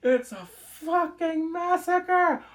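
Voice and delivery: slow, high, drawn out in disbelief; high drawn-out outcry; stunned exclaim. Real, not acted. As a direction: stretch every word in horror. Reacting to the killings.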